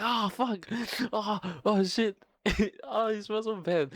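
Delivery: high-pitched retching squeal breaking into a laugh; squealing disgust, laughing at the end; mock gagging kids. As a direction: play it like a boy recoiling from a stink. Friends recoiling from toilet smell.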